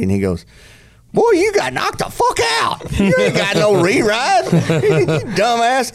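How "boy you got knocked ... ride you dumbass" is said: mocking taunt. Friend mocking dazed rider.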